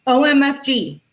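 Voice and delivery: high exasperated O M F G; acted-out high exclamation; mock outrage. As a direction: spell it out in put-on outrage. Acting out girlfriend's outraged text.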